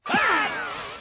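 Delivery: high, loud yelp of fright; startled scream. Acted. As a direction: scream at a sudden surprise. Startled by sudden greeting.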